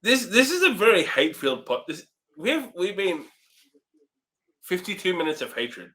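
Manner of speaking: ordinary delivery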